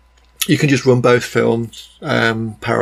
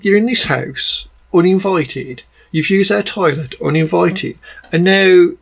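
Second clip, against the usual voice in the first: raised-pitch, incredulous telling-off; mock indignation; 6 semitones higher than usual. Reviewer scolding Max for barging into the house, indignant. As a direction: lay out the offences, higher-pitched and indignant. Acted indignant thought at the character.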